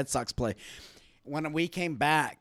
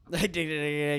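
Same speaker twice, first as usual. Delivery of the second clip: ordinary talk, nothing marked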